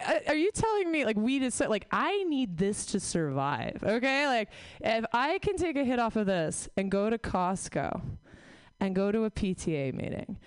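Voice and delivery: ordinary delivery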